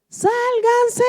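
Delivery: frantic warning shout; retold shouting. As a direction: yell it as men fleeing an avalanche. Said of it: "venían todos gritando".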